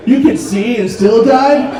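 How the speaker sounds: slow, mocking disbelief, then scornful; mock roasting. Blind victims roasting the sighted ones, mock scorn. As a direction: jeer at them in slow mock incredulity. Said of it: "just roasting all the normal people".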